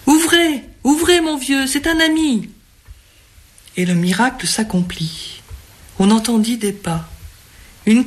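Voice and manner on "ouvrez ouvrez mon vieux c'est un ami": high, urgent calling through a locked door; pounding on the door, calling the owner to open; commanding call. A narrator's reading. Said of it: "appela d'une voix impérieuse".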